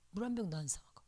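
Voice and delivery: whispered 물 한 병도 안 사 먹어, soft and voiceless. Shown as a demonstration. Pastor's wife repeating to herself, whispered. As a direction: say it again under the breath. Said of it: "repeated louder then whispered".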